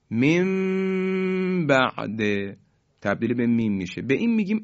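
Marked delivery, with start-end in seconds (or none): 0.1-2.2 من بعد drawn out slowly as mimba'd, chanted higher; Quran recitation. Shown as a demonstration. Teacher demonstrating iqlab in slow higher recitation. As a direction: intone من بعد slowly with nasal mim. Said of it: "به این شکل".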